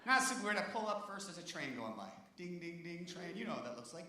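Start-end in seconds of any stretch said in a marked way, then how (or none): 2.3-3.4 crossing bell imitation. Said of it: "imitating crossing bell".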